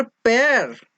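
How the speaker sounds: Pair drawn out slowly with extra weight; stress on pair. Shown as a demonstration. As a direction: lean hard and long on pair. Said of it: "इसमें ज्यादा जोर दिया है बोलते वक्त". (Pair पर ज़ोर देकर, खींचकर)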